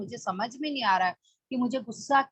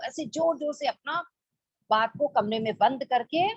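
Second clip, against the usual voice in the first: ordinary delivery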